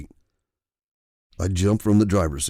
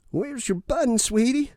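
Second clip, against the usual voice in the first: put-on high, puzzled old lady voice rising at the end; quizzical old lady. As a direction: ask like a puzzled grandmother. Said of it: "quizzically asked".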